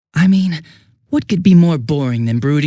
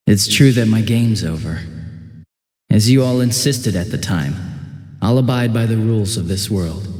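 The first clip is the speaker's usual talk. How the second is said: lower, measured letter voice; letter read aloud; 4 semitones lower than usual. Acted. Convict reading his letter to the teenagers, subdued. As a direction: read it like a calm confession.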